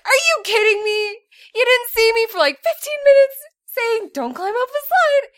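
high loud indignant protest; indignant outrage. Retold or imitated. Retold indignant reaction.

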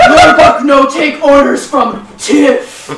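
loud, slow, broken-English brute; dim orc voice. Acted. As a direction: boom it out like a dumb orc. Orc character answers threat.

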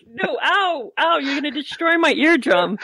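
mock-pained yelp of complaint; pained protest. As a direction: play hurt ears, protesting. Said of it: "And Arlen's like".

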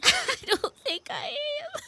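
high, slow, weepy wail; breaking into tears. Acted. Mother admits to friends she isn't okay, crying. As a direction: voice climbing as the crying takes over.

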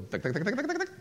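quick ticking rising in pitch; rattled-off mechanical tuk-tuk; rotary dial clicking. Retold or imitated. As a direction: imitate a dial spinning back. Imitates a rotary phone dialing.

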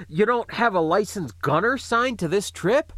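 strained between coughs; coughing. Acted. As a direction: push through a cough. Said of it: "it got him coughing again".

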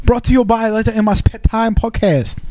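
put-on high-pitched caricature Chinese accent; hammed-up Chinese accent jingle read; mock Chinese accent. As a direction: badly fake a Chinese sage's accent. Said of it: "makes it sound like Confucius".

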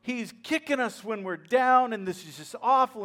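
hammed-up complaining; imitated victim mentality. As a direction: pile up the woes like a self-pitying victim. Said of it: "taking on this victim mentality".

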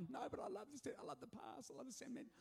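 high, soft pleading whine; half-whispered, high plea to keep the box; pleading sentimental self. Retold or imitated. Husband pleads with wife over keepsakes, high soft whine. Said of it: "I'm like".

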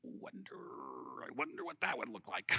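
breathy whisper to himself; whispered musing. Real, not acted. Pastor musing aloud to the congregation, whispered. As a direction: half-whisper the thought.

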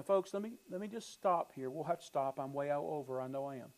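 ordinary talk

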